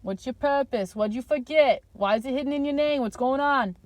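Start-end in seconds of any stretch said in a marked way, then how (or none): none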